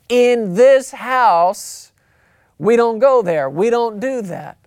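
loud, drawn-out parental decree; stern parent. Acted. Parent firmly setting house rules for the kid. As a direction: lay down the law to the kid.

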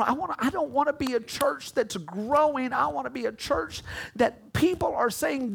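ordinary talk, nothing marked

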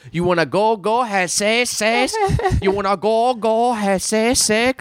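teasing impression of a street catcaller; mocking a broken-English catcaller. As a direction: ham up the creepy stranger's pitch. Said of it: "could barely speak English and was like".

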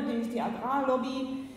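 ordinary delivery

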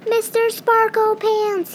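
high, loud, slow drawn-out call; a mouse calling for his missing friend. Acted. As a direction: call across the moon for him.

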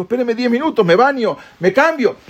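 high, begging for a few minutes; pleading Haman. Retold or imitated. Haman begs for time.

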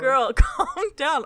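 exasperated, laughing, slowed way down; laughing exasperation. Real, not acted. Exasperated at the character.

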